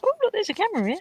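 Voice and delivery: squeaky excited stranger; high mock voice. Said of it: "someone saw the camera and was like".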